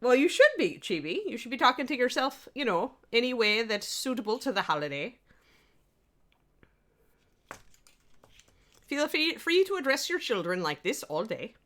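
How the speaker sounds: lilting Irish accent, pitched high; put-on Irish accent, swinging upward. Shown as a demonstration. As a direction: tease in a singsong Irish brogue. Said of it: "I could do my entire stream in Irish".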